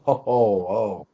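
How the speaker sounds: normal talking voice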